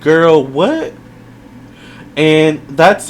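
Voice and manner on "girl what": high, drawn-out disbelief; a puzzled "Girl, what?" with a stretched, high "what"; sassy girl what. Shown as a demonstration. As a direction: read it like a baffled, sassy note. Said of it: "what I wrote on my paper for Chaos is".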